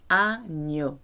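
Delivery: agneau said an-YO, the gn like Spanish ñ. Shown as a demonstration. Teacher demonstrates agneau's ñ sound to the class. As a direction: agneau with a clear ñ sound in the middle. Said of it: "G N is a ñ sound".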